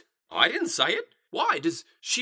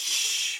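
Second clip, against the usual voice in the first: sharp, drawn-out shush; quick conspiratorial shh; hushing shh. Acted. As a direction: hush them to keep a secret. Hushing her about the secret.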